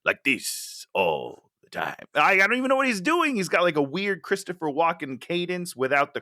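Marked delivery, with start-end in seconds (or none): 0.1-1.9 low, slow and flat; flat delivery. Retold or imitated. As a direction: imitate an actor's emotionless drone, dropped low. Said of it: "he's so fucking flat in this movie".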